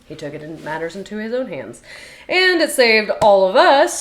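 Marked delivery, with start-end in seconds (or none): 2.3-4.0 emphatic. Real, not acted. Emphatic point about salvation.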